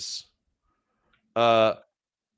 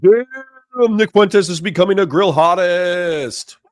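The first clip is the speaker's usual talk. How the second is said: mock voice; 6 semitones higher, 7 dB louder, slower than usual. Mocking reaction to the clip.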